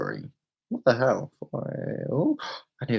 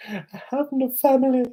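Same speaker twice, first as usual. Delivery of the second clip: higher, hammed-up lament; playful despair, pitched up; mock wail rising; 4 dB louder than usual. Building self-pity.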